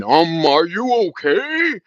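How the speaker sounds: slow, high, drawn-out mock "are you okay"; mock concern. Mimicking a viewer who chimes in, slow mock concern. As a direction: stretch it out like a fake-caring viewer. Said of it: "somebody wanna chime in".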